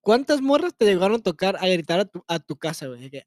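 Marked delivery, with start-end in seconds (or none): none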